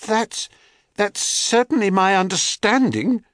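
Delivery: high, hesitant nervous reply; frightened stammer. A narrator's reading. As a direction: stumble over the words in fear. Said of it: "stammered a frightened Echo".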